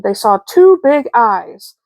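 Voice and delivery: plain reading voice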